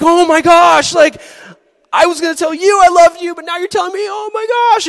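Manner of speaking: very high, loud gushing; bubbling over with joy; giddy excitement. Pretend. Teen hears crush's confession, squeals high and loud.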